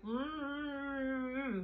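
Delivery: mumbled mm-hmm with lips sealed; muffled closed-lip hum; closed-mouth hum. Shown as a demonstration. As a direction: hum the words through a shut mouth. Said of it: "it's gonna come out like".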